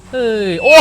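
sudden high, loud cry of alarm; startled shout. Acted. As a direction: yelp out in shock as someone flips past. Uncle startled by a stunt.

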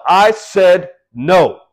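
put-on slow, loud, stern no to a child; firm parental no. A parent putting their foot down with a child, slow and loud. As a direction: lay down the law, drawn out.

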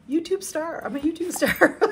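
high, loud, ending in laughter; excited high voice tipping into a laugh; gleeful squeal. Real, not acted. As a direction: crow it joyfully and crack up. Excitedly reading gift, jokes about fame.

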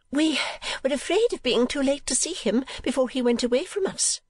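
high-pitched voice of an anxious mother; fretful mother. Acted. Mother embracing her departing son, high fond voice. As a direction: play the mother in a high register.

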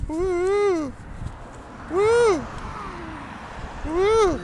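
excited cheers. Real, not acted. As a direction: whoop for each move. Cheering child's dancing.

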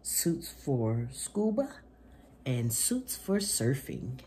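bright, lively picture-book reading voice; reading to children. Acted. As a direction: read it to little kids with gusto. Reads picture book aloud.

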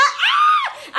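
shouting with excitement; excited shout. Real, not acted. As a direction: burst out with a loud excited cry. Excited about surprise.

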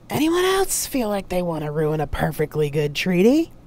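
loud challenge shouted out; yelling at crowd. A narrator's reading. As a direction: call out to the whole room. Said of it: "then yelled at the crowd".

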